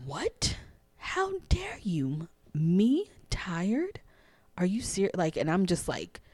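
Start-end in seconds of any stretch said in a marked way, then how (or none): 0.1-5.2 high, drawn-out offended disbelief; incredulous, pitched-up retort to an outrageous suggestion; mock indignation. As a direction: act scandalized, stretching the words in a higher pitch. Retold offended reaction to coach.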